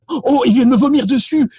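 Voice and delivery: high-pitched feigned alarm; mock panic. Fake lost tourist pressing someone on the phone, high mock panic. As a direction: play a flustered parent losing control. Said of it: "vous commencez à paniquer".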